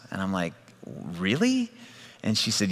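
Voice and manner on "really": high rising disbelief; incredulous question. Retold or imitated. Preacher hearing a young lady's startling claim, incredulous. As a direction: let the pitch leap up in doubt.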